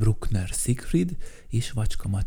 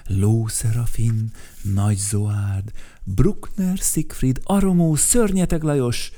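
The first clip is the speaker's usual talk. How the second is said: plain reading voice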